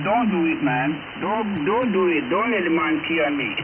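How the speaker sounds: ordinary talk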